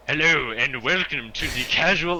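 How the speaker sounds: mock booming show intro; imitating announcer voice. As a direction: parrot the announcer welcome, hammed up. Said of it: "is that your, like, announcer voice?".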